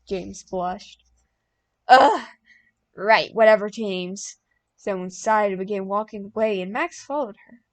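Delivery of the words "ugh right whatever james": annoyed. A narrator's reading. Annoyed reply after being startled.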